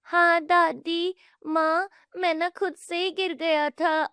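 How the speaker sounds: put-on high, slow child voice; small boy owning up; little boy. Grandson telling grandma he fell himself, high child voice.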